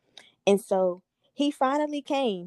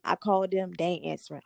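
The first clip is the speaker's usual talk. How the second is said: ordinary delivery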